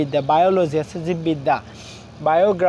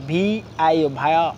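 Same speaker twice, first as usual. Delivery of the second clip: Violation spelled out in chunks with short pauses; broken into syllables; much faster than usual. Shown as a demonstration. Teacher showing learners how to pronounce a big word, syllable by syllable. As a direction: sound out each piece of violation after its letters. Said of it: "আমরা ভেঙে ভেঙে".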